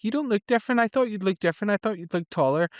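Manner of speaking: high whiny rant, lightly voiced; mock rant. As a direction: play Harmony sulking at Spike. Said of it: "goes off on a wee rant".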